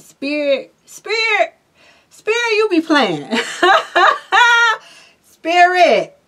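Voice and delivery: high, loud giggly calls to spirit; laughing. Real, not acted. Reader laughing at spirit's timing, high and giddy. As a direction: crack up at the uncanny coincidence.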